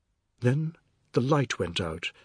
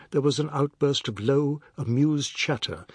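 ordinary delivery

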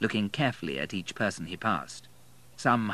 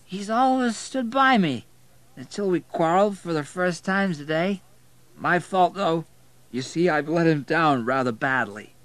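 put-on high, loud drunken confession; slurred drunk; 9 semitones higher, 5 dB louder than usual. A narrator's reading. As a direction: slur it out, pitched high. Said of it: "slurring his words".

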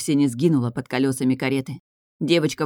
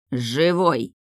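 barked. A narrator's reading. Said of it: "рявкнул Соргин".

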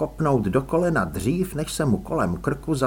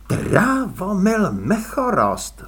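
slow, higher exclaimed name; exclaiming; 7 semitones higher, 4 dB louder, slower than usual. A narrator's reading. Elánius realizes who it is, exclaims slowly. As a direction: call out the name as it dawns. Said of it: "zvolal Elánius".

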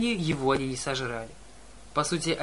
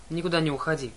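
ordinary delivery, nothing marked

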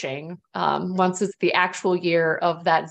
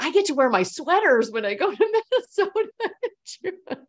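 excited member quote; 7 semitones higher than usual. Retold or imitated. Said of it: "So they're like".

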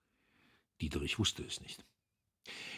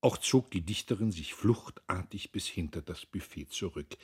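plain reading voice

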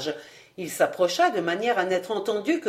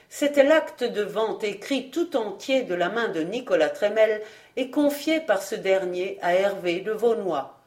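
ordinary delivery, nothing marked

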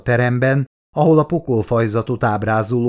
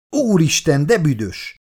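higher-pitched appalled outcry; disgusted exclamation; 6 semitones higher than usual. A narrator's reading. Colleague covers his face at the stench, appalled cry.

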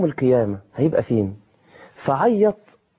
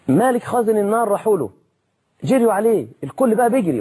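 excited storytelling; 8 semitones higher than usual. A narrator's reading. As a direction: build the panic of the running crowd. Dramatic retelling of rushing crowd.

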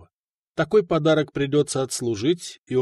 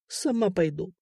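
soft, crushed surrender; dejected; 5 semitones higher, 5 dB quieter than usual. Acted. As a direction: give in, defeated and quiet. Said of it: "поникла Людмила".

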